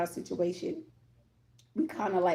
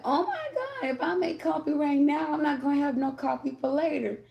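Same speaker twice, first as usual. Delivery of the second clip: high, whiny pouting voice, sliding down; 6 semitones higher than usual. Retold or imitated. Retelling her pouting over the last coffee, high and whiny. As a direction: whine like a sulky child about the coffee. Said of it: "I was pouting".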